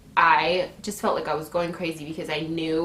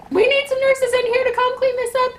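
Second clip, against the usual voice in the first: very high, loud mock staff voice; calling for help; 5 dB louder than usual. Staff call nurses to clean flooded floor, high urgent shout. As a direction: imitate an urgent shout for backup.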